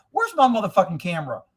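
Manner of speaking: usual speaking voice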